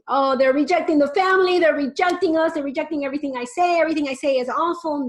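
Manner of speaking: high, loud, fast wail of a worried parent; mock panicked parent. Speaker mocking parents who feel rejected, high and loud. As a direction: ham up the parent's dramatic dismay. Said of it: "this idea of rejecting".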